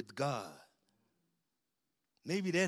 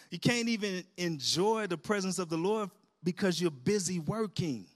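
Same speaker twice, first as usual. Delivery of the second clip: usual speaking voice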